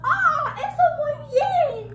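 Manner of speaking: high, loud, drawn-out praise; slow, effusive Spanish praise; gushing teacher. Retold or imitated. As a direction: imitate a teacher delighted with a pupil. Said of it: "And she was like".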